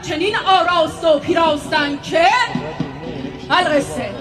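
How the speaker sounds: grand formulaic storyteller opening; ornate, sweeping recital to the crowd; epic teahouse storyteller. Acted.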